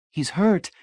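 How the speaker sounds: high, feeble protest. A narrator's reading. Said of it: "Marcia said feebly".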